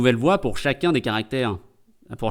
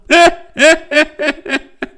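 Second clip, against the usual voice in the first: loud, high burst of laughter; real laughter; 13 semitones higher, 7 dB louder than usual. Real, not acted. Streamer after calling Eric a sherpa, laughing out loud. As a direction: crack up at your own joke.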